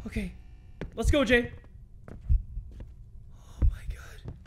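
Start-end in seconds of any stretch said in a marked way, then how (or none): 2.1-4.3 real hushed, creeped-out whisper; frightened whisper. Real, not acted. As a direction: whisper it under your breath in dread. Creeped out in horror game.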